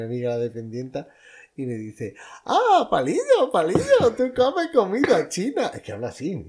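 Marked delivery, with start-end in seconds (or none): 2.5-5.7 put-on high, slow broken Spanish with a Chinese accent; imitating the shopkeeper. Imitating the Chinese shopkeeper's accent as she hands over chopsticks. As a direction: squeal it out like the shop lady. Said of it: "es que la estoy imitando".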